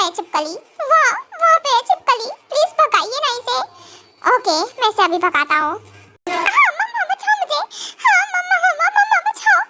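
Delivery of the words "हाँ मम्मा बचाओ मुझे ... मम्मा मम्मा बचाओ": high frantic cries for mummy; panicked shriek. Acted. Scared bride shrieking for her mother at the lizard. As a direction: shriek as the lizard comes close. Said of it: "इसे चिल्लाना बंद करो".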